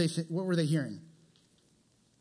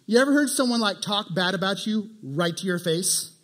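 ordinary delivery

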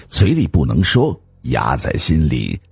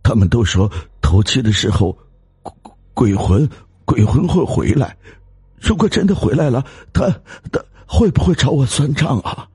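higher, faster, stumbling with fear; frightened stammer; 8 semitones higher than usual. Acted. Husband stammering about ghost. (结结巴巴地害怕)

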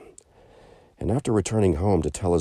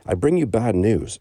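sailor's news; 6 semitones higher, 5 dB louder than usual. Acted. Sailor brings bad news.

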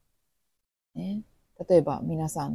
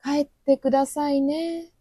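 more refined; 9 semitones higher than usual. Shown as a demonstration. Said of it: "もうちょっと上品に言いましょうかね".

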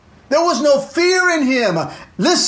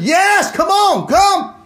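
excited call; 7 semitones higher, slower than usual. Acted. Jesus calling Peter out.

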